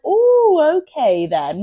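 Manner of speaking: mock intrigued surprise; acted surprise. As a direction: play pleasantly surprised, swooping down from high. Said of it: "a bit of a surprise".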